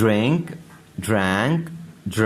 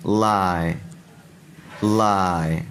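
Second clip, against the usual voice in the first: ordinary talk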